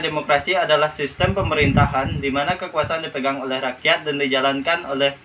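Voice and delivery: normal talking voice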